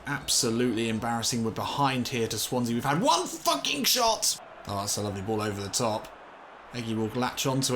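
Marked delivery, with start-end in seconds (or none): 2.7-4.4 high, slow exasperated cry; exasperated outburst. Real, not acted. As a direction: wail it in disbelief. Frustrated at the game.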